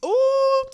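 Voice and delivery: high loud hooting ooh; excited ooh. Real, not acted. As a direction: squeal an amazed ooh. Reacting to six threes.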